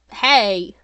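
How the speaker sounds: loud indignant shout of hey. Acted. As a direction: yell it in protest. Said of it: "Angel shouted".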